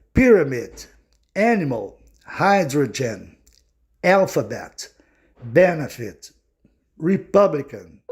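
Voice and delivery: English word list, "pyramid" to "acrobat", each stressed third syllable from the end; English pronunciation. Shown as a demonstration. Teacher demonstrates English words to students, stressed third from last. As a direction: stress the first of the last three syllables in "pyramid", "animal", "hydrogen". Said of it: "elas são proparoxítonas".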